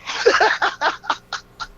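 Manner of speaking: high-pitched burst of laughter. Real, not acted. Co-host reacting to an insult, high laughter.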